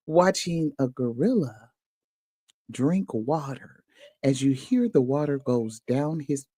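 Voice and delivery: lightly voiced hushed nature documentary narrator; mock nature documentary. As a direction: narrate the gorilla drinking like a wildlife film. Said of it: "nature documentary narration parody".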